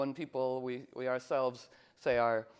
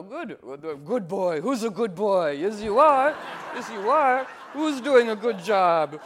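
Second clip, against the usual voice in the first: dog praise voice; 7 semitones higher, 9 dB louder than usual. Pretend.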